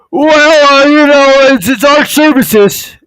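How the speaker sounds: high, squeaky, whiny excuse; mock the doctor squirming with a high whine; flustered doctor.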